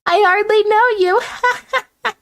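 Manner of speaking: high, slow, coy protest; flustered girly voice. Acted. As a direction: drag it out bashfully. Miriam flustered by Clank.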